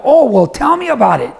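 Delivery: put-on high, eager interest for a child; encouraging dad. Dad asks daughter about her game, encouraging. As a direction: play up your interest to a kid.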